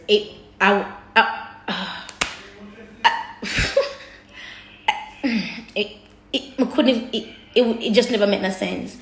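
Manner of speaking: fighting tears, halting and stuck on words; choked up. Real, not acted. Vlogger struggling to say what shook them, choked up. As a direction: stammer and sigh, trying not to cry. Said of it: "You can't be out here crying".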